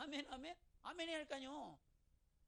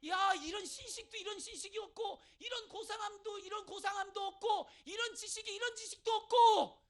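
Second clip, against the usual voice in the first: fervent shouting; 7 semitones higher, 10 dB louder than usual. Acted. Climactic preaching.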